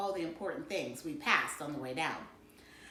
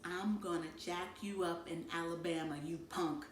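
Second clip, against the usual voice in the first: near silent, barely voiced; silently mouthed threat; 3 semitones lower, 9 dB quieter than usual. A narrator's reading. Byron mouths a threat at his brother, near silent. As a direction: mouth it so parents can't hear. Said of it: "used silent mouth language".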